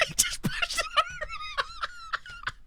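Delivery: very high, squealing through laughter; helpless real laughter. Real, not acted. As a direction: crack up while getting the words out. Cracking up at a player's blunder.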